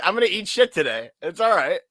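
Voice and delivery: breezy acceptance. Retold or imitated. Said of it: "I was like".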